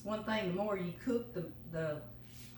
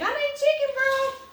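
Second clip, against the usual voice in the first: high, loud, panicked customer bursting in; squealed high, loud plea of someone rushing in; frantic shopper; 9 dB louder than usual. Pretend. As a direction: play the desperate last-minute shopper. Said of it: "I'm gonna be that person running in".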